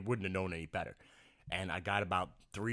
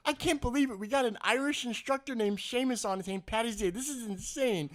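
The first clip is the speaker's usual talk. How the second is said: high, loud mock amazement; amazed customer; 12 semitones higher, 4 dB louder than usual. Lady marvelling at her Irish instructor, high imitated amazement. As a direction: gush in disbelief, pitched way up. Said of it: "the lady goes".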